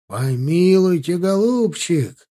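a slow high fond exclamation; exclaiming. A narrator's reading. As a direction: protest warmly, dear fellow. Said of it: "воскликнул профессор".

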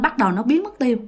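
plain reading voice

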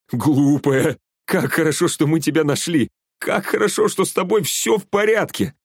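high, excited exclamations; fond, elated outburst; joyful relief. A narrator's reading. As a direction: gush with relief at finding them safe. Глеб rejoices over found friend.

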